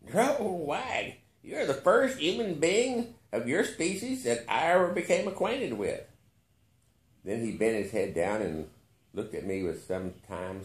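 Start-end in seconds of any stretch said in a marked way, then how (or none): 0.0-6.0 put-on higher, amused old billionaire; amused old man. A narrator's reading. Billionaire amused by the narrator, higher voice. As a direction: tease the odd visitor. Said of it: "whistling softly through the gold".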